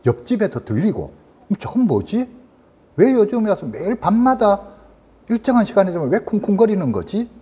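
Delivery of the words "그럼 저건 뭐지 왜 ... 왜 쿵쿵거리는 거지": higher-pitched imitation of a bothered patient; puzzled questioning. 환자의 의문을 대신 말함. (의아해하는 높은 목소리로 환자 흉내)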